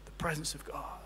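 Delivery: hushed whisper on the presence of God. Acted. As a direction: breathe the words out like a secret. Preacher drops to a hush.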